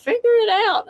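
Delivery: high-pitched, imitated self-scolding; fussing at oneself. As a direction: act out fussing at yourself, pitch shooting up. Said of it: "I was fussing at myself".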